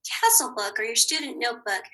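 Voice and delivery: ordinary talk, nothing marked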